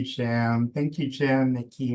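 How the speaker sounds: ordinary talk, nothing marked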